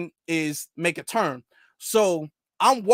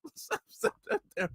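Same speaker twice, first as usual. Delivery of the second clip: high, very soft whispered line; breathless whisper; 12 semitones higher, 9 dB quieter than usual. Retold or imitated. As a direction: whisper it in quiet panic. Panicked whisper after sigh.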